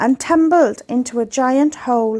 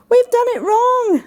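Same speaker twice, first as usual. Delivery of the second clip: much higher, dismayed penguin; upset penguin; 11 semitones higher, 3 dB louder than usual. A narrator's reading. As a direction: wail it high in dismay. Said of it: "said Penguin Pete".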